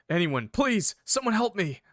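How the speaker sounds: acted cry for help; pleading. A narrator's reading. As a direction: beg desperately for someone to help. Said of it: "you pleaded".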